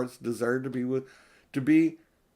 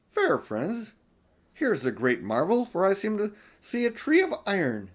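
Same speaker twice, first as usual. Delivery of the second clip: higher, lightly voiced queen marveling; a regal lady pointing out a marvel; queenly wonder; 7 semitones higher than usual. A narrator's reading. Queen Susan voiced in story.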